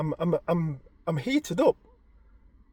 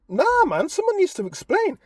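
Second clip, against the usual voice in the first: high, louder nah man; irate demand; 6 dB louder than usual. Retold or imitated. Husband demanding answers from wife, high irate. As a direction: insist someone explain.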